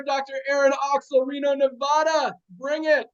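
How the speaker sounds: usual speaking voice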